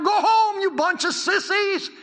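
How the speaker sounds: high, loud jeering holler; yelled-down taunt. Acted. Philistines yelling down at Jonathan, a high loud jeer. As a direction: yell it down to the climbers, stretching the end. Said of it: "They could have yelled down".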